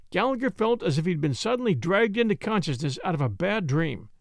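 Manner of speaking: ordinary delivery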